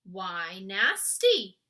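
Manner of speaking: slow, loud puzzled giant; frowning giant. A narrator's reading. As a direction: drag out the question, frowning.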